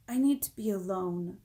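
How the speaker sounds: usual speaking voice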